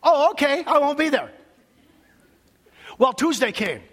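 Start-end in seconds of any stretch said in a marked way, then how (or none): 0.0-1.3 mock hurt compliance. Pastor mimics his own disappointed reply.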